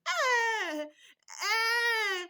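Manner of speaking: put-on high loud ah, ah cry of a baby in pain; hysterical cry. Shown as a demonstration. Mother imitating a colicky baby for mothers, high loud ah. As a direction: wail ah like a baby with gas pain. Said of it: "crying hysterically like".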